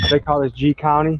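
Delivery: usual speaking voice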